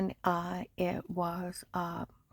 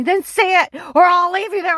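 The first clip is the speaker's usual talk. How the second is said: much higher, much louder mock yell; retold shout; 11 semitones higher, 14 dB louder than usual. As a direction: shout the ultimatum down at her. Agent's ultimatum retold.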